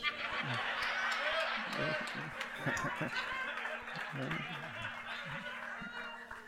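long delighted laugh; helpless laughter mid-sermon. Real, not acted. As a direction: break off into a real, lasting laugh. Preacher laughs at own point.

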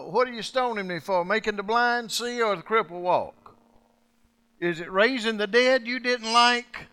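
high, loud mocking questions; sarcastic Jesus. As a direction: taunt the stone-throwers with sarcasm. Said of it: "I love the sarcasm of Jesus".

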